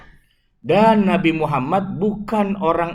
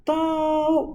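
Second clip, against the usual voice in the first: long, high-pitched "Tahu"; imam's clueless reply; 12 semitones higher than usual. Retold or imitated. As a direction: mimic a clueless shrug, stretched out high. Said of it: "dia bilang begitu". ("Tahu" dipanjangkan dengan nada tinggi)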